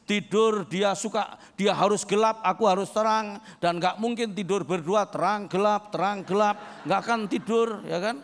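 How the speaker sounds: usual speaking voice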